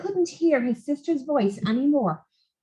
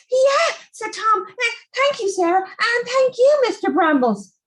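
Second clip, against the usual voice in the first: put-on relieved boy; 9 semitones higher than usual. Tom character voice.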